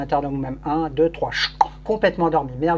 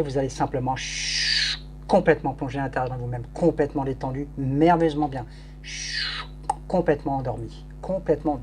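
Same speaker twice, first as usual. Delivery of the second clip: hypnotic induction; 3 semitones lower than usual. Acted. Hypnotist leads trance induction.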